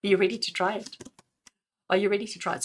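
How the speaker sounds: ordinary talk, nothing marked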